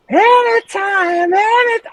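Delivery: very high, slow sung anytime; singer showing off range. Retold or imitated. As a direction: croon it sky-high, effortless. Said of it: "And he's".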